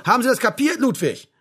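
high, loud, barked question; angry repeated demand. Acted. Sergeant cuts off Ludwig's objection, barking angrily. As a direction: cut off the excuse harshly.

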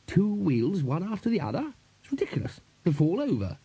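high, swooping character voice, softening at the end; fussy, indignant Bursar. A narrator's reading. Bursar objects to the two-wheeled cart, high querulous voice. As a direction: prattle on in high-pitched complaint.

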